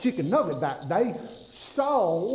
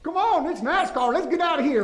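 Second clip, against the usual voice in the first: higher, pushing to race away; excited higher call to get moving; urgent hurry; 8 semitones higher, 3 dB louder, faster than usual. Retold or imitated. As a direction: hurry yourself up like a race driver. Urging escape from gator.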